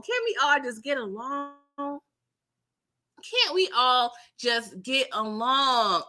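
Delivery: slow, stretched-out plea; drawn-out plea. Acted. Pleading with rowdy club fools, slow and stretched. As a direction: stretch every word like a weary plea. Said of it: "Like, come on".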